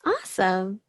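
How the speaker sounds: usual speaking voice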